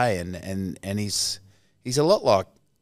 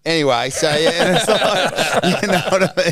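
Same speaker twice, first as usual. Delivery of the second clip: laughing; 7 semitones higher, 5 dB louder than usual. Real, not acted. Laughing wrap-up, loud and high.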